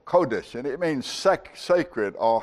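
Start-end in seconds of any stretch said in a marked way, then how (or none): 0.0-0.5 Kodesh with the first syllable stressed, KO-desh. Shown as a demonstration. Teacher offering the other pronunciation, Kodesh stressed on KO. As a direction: say Kodesh as KO-desh, leaning on the start. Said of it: "the emphasis is on the first syllable".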